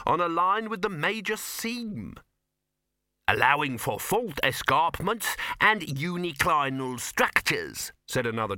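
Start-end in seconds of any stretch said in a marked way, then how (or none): 0.1-2.2 high-voiced pedant unrolling expertise; expansive dwarf. A narrator's reading. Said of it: "settle down to a good long explanation". 3.3-7.9 slow, deliberate dwarf expert; higher, measured pedantic interjection; slow caveat. Acted. Dwarf adds a technical caveat.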